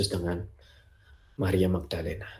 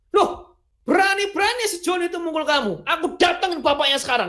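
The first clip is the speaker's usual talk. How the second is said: higher, a touch of mock outrage; indignant father. Father outraged that Joni hit his child, higher voice. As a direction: protective dad ready to march over. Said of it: "tapi satu sisi marah".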